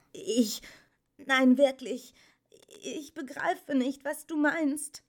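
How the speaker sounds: high, halting, bewildered voice; flustered stammer. A narrator's reading. Atreyu fails to understand the Empress, stammering. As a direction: stumble and restart in confusion. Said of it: "stotterte er".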